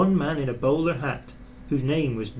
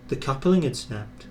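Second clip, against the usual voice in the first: ordinary delivery, nothing marked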